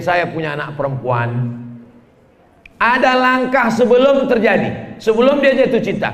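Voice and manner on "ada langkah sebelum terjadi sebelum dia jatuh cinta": emphatic. Real, not acted. Menekankan nasihat kepada orang tua.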